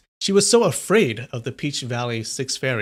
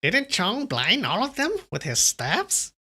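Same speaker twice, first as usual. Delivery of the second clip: high witchy voice; cackling witch; 9 semitones higher than usual. Acted. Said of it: "She's kinda sounding like a witch now".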